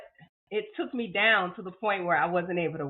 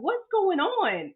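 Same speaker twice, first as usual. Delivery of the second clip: alarmed question; 11 semitones higher, slower than usual. Retold or imitated. Retold alarm at symptoms.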